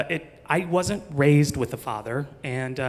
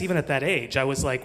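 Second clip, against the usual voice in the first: usual speaking voice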